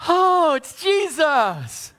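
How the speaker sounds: high, slow, loud dawning joy; delighted surprise. Pretend. Disciples finally recognize Jesus, happily surprised. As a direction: let the recognition burst out slowly. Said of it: "Then they're like".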